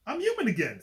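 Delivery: high, loud joyful cry; overjoyed relief. Acted. Doctor turning human, crying out with joy. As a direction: burst out with relief.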